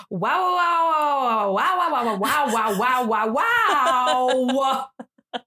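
long chain of drawn-out wows, building; dramatic. Acted. Zola reacting to the Backpage news, dramatic. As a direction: stretch and repeat it theatrically. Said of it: "little dramatic there".

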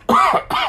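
a real cough; coughing fit. Real, not acted. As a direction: clears it with a cough. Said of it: "I don't know why I'm coughing so much".

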